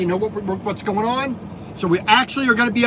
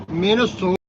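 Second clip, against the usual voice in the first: Minnesota accent; 3 semitones lower than usual. Shown as a demonstration. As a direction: say Minnesota the Minnesota-accent way, nasal first vowel. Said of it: "the proper bad Minnesota accent".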